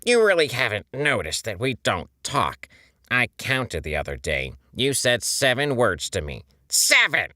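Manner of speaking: mounting rage. A narrator's reading. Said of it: "his mounting rage".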